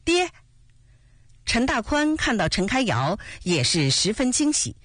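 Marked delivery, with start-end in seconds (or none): 0.0-0.3 short glad cry to a father; joyful call. A narrator's reading. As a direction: call out to Dad, happy to see him. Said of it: "欢喜地喊了声". (欢喜地喊一声)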